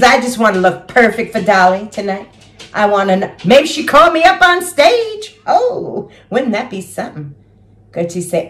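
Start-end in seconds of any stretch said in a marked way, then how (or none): none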